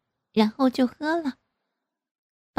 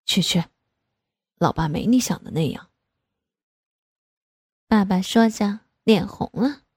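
father's voice; 2 semitones lower than usual. Acted.

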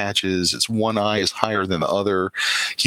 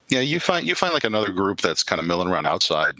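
ordinary talk, nothing marked

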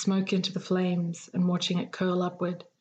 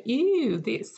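dainty disgust; 6 semitones higher than usual. A narrator's reading. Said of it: "acting like a big dainty thing".

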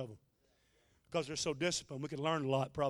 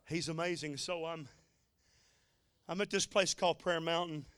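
ordinary delivery

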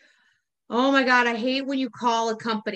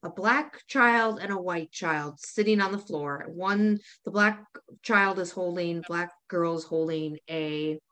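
low, subdued, careful description; serious; 6 semitones lower, 4 dB quieter than usual. Real, not acted. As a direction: bring it down and lay out the facts softly. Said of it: "Let's get serious for a second".

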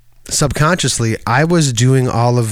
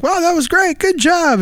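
much higher, effusive praise; gushing praise. Retold or imitated. Imitating people praising a performance, high. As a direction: gush in a very high voice. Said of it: "people being like".

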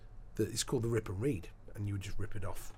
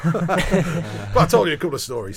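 laughing; 7 semitones higher, 13 dB louder than usual. Real, not acted. Laughs at his own digression.

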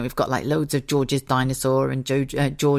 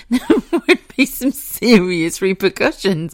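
mock threat breaking into laughter; laughing; 3 semitones higher, 5 dB louder than usual. Real, not acted. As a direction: warn them while laughing, loud.

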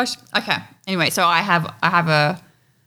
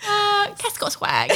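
stuck high pitch; 14 semitones higher, 3 dB quieter, slower than usual. Real, not acted. Laughing at her own joke.

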